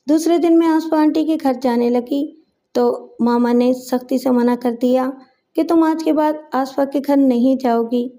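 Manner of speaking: ordinary delivery, nothing marked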